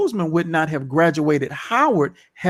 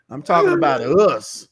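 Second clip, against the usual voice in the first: normal talking voice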